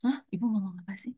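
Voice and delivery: quick, whispered puzzled question; retold confusion in a rapid whisper; imitating puzzled teen. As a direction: whisper it like a baffled teenager. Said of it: "saya justru ngomongnya pelan. Jadi dia".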